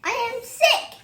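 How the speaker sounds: bright, high and cheerful; happy about being sick. Acted. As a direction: announce it like good news. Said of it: "not the best way to pronounce that you're sick".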